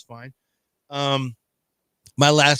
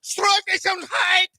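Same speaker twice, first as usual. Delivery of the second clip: high-pitched shouted German announcing; mock German announcer; 3 dB louder, slower than usual. Announcing a tennis champion to Germans, hammed-up German shouting. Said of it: "That's how the Germans would probably announce it".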